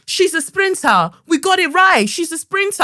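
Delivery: high, excited mock call across the room; imitated calling out. Reception guy calling to the teacher, high excited shout. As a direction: call over with the big news. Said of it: "He calls to him and he goes".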